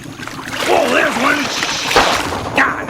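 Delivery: high yelp of disgust; startled yelp. Acted. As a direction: squeal at the sight of tentacles. Sees a tentacled creature.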